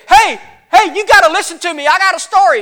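high, loud, urgent burst; excited shepherd calling out. Acted. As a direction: run up breaking big news, insisting on being heard. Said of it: "they run up to you and they say".

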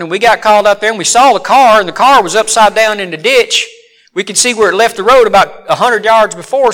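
ordinary delivery

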